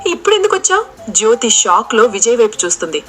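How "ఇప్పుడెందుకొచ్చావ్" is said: frightened. A narrator's reading. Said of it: "భయంతో వణికిపోతుంది".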